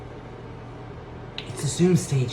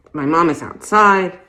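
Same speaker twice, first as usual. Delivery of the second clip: louder, pushed through a mask; stifled scream; 4 semitones higher, 7 dB louder than usual. Acted. Shopper yelling to the clerk through her mask, louder. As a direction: yell to be heard behind the mask. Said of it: "trying to scream as loudly as I can".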